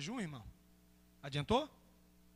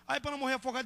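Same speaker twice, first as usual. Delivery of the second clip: ordinary talk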